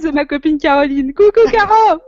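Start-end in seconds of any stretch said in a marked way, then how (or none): 1.1-2.0 high, loud sing-song hello; calling out to a friend; cheerful greeting. Real, not acted.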